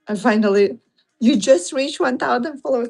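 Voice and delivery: ordinary delivery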